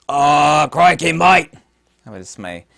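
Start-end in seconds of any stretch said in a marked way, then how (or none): 0.1-1.5 loud, higher hammed-up Aussie exclamation; horrible Australian accent. Showing off a gift knife, horrible Australian accent. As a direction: blurt it in a bad broad Australian accent. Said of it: "my horrible Australian accent".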